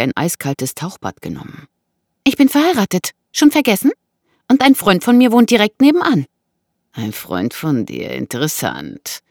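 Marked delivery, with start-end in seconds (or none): 2.2-3.9 high, loud rebuff; indignant woman. Acted. Married woman rebuffs the intruder, indignant. 4.5-6.3 high, loud and indignant; warning woman. Acted. Woman tells intruder of a friend next door, warning. 6.9-9.2 slow, low mocking echo; taunting man. Intruder echoes her claim about a friend, taunting.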